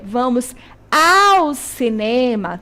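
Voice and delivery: "vamos ao cinema" said slowly, leaning on "ao"; slow teacher model "vamos ao cinema"; slow corrected phrase. Shown as a demonstration. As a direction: spell out the correct form, hitting "ao". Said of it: "O correto é".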